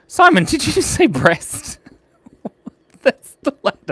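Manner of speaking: high-pitched puppet voice mocking a slip; squeaky teasing voice. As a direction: tease him in a high squeaky character voice. Mock teasing in a second voice.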